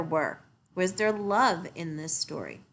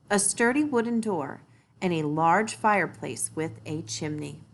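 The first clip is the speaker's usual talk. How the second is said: usual speaking voice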